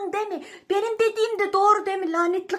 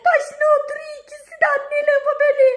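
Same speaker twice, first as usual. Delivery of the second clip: being tickled; 8 semitones higher, 7 dB louder, faster than usual. Real, not acted. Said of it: "being tickled".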